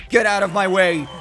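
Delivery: loud, playful order to a rival; commanding shout. Pretend. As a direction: shout them out of the path. Orders a rival aside in game.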